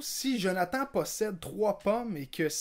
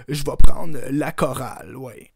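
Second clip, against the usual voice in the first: loud premier answer; 2 semitones lower, 16 dB louder than usual. Acted. Legault choosing answer.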